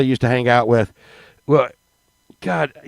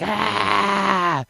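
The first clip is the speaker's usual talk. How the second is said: short startled shout; 5 semitones higher, 7 dB quieter than usual. Retold or imitated. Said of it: "she was like".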